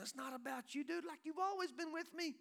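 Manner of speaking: high-pitched, rousing plea to a son; impassioned fatherly appeal, voice lifted high; exuberant father. Acted. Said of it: "He said to the older son".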